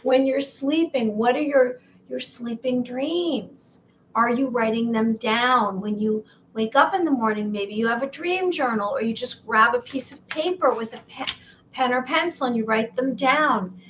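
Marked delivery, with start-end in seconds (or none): none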